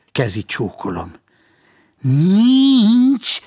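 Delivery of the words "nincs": Nincs? thrown up high and loud in disbelief; incredulous echo. A narrator's reading. Policeman repeats Ervinke's answer, stunned and incredulous. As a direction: echo it as if you can't believe your ears.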